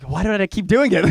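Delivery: high, loud self-exasperation breaking into laughter; real helpless laughing protest; laughing at himself. Real, not acted. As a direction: crack up at your own slip. Cracks up at own accent slip.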